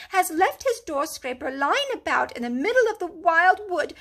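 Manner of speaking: high, loud, fussy grumble; a prim animal lecturing; huffy Mole. A narrator's reading. Mole lectures Rat about the careless owner, huffily. Mole's voice held.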